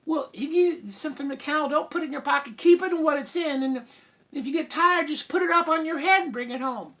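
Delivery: high-pitched mom, reining herself in; exasperated mother, instructing. Acted. Mother telling Jack about the cow, exasperated. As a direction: lay down the rule in a high voice.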